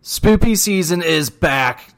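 loud, high, slow announcement; announcer voice. Acted. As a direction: boom it like a spooky trailer. Announces the Halloween theme.

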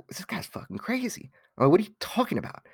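high, hushed retold disbelief; incredulous aside. Artist privately baffled by a stranger's question, incredulous aside. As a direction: say it under your breath, baffled by him.

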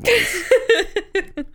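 squeaky high laugh through the words. Real, not acted. Host teasing his cohost about saved stories, high giggly voice.

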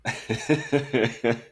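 short laugh at himself; amused laugh. Real, not acted. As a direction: chuckle it off. Laughs at his own intro.

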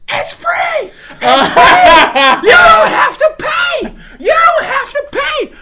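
put-on high, loud excited kid shouting; kid yelling. Kid announcing the free game to everyone, shouting. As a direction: yell it across the arcade like an overexcited child. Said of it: "starts going off".

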